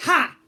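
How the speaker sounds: very loud, high single laugh; triumphant laugh. A narrator's reading. Pirate girl laughing at the fleeing guards, loud laugh. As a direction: crow with a "ha". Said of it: "Tempestra laughed".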